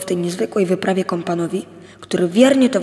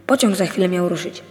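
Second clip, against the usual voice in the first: ordinary delivery, nothing marked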